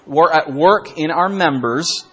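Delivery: preaching emphasis. Acted. Preacher reads Romans to the congregation, slow and emphatic. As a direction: lean hard into the scripture line. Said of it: "reading scripture slowly".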